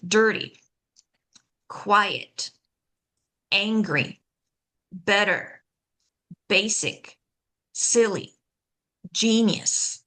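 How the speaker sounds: DIR-ty, QUI-et, AN-gry, BET-ter, BA-sic, SIL-ly, GE-nius; "dirty" through "genius", each front-stressed; first-syllable stress. Shown as a demonstration. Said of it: "stress that first syllable".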